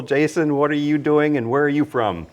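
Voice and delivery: ordinary delivery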